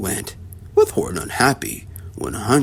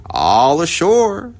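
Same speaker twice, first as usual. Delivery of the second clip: slow, drawn-out call; men shouting; 4 semitones higher, 3 dB louder, much slower than usual. A narrator's reading. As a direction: stretch out the call like a deckhand. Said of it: "the men shouted".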